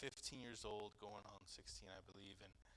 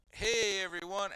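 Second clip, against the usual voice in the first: much higher and louder, fake-cheery hey everyone; overloud greeting; 9 semitones higher, 15 dB louder than usual. Greeting the church crowd in his living room, overloud. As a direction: cover your guilt with a big hello.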